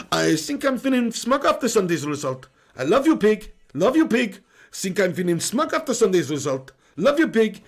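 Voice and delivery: hammed-up Portuguese Mourinho accent, laughing through it; a playful put-on Portuguese accent; mock Mourinho. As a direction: camp it up as Mourinho. Repeating Mourinho chat in voice.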